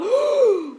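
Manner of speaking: high, drawn-in gasp of wonder; delighted gasp. Real, not acted. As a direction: gasp as it sinks in. Looking at the sketch.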